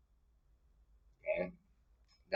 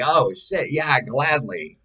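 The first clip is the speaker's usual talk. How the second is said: eager yes; 8 semitones higher, 6 dB louder, slower than usual. Pretend. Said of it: "And I'll be like".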